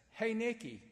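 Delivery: usual speaking voice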